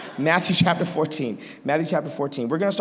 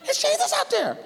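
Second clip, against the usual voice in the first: very high, excited squeal; vindicated I-told-you-so in a squeaky voice; Peter; 13 semitones higher than usual. Acted. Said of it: "And Peter's like".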